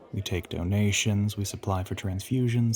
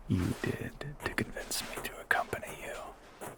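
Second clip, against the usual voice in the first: whisper; 9 dB quieter than usual. Acted.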